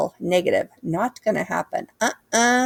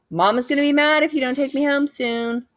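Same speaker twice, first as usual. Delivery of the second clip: put-on whiny kid; 8 semitones higher than usual. A narrator's reading. Boy pleads with sister.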